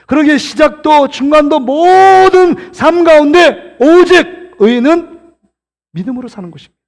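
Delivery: impassioned, sustained pulpit cry; preaching climax. Real, not acted. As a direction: stretch "모든" and "오직" with full fervour.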